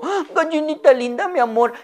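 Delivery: high, sweet and loving, talking to a pet hen; high affectionate lady voice; doting lady voice. Acted. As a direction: coo it like a fond owner greeting her little hen. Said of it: "le dijo".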